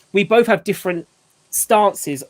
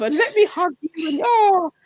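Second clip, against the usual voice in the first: higher cooing voice, trailing off; mock doting mummy; 10 semitones higher than usual. Dad mocking mums' hugs, higher and cooing. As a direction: keep gushing over the child. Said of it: "mummies might be like".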